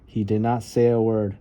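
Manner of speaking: ordinary delivery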